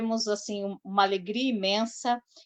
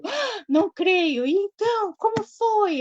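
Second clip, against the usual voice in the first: much higher, slow, louder astonished gasp; mock disbelief; 12 semitones higher, 4 dB louder than usual. Host imitating Silvia's thrilled disbelief, high and drawn out. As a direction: play the fan who can't believe it. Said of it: "não expressei com toda a entonação de voz dela".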